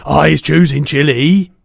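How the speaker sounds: a big brute bragging loudly; loud giant. A narrator's reading. Bloodbottler mocking the BFG, loud and boastful.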